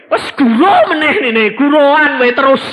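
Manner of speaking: loud, high, laughing son's protest; laughing complaint. Retold or imitated.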